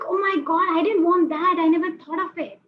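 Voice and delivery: high, loud "oh my God" shock; shocked outcry. Pretend. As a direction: squeal in sudden cold-water dismay. You in sprinkler shock.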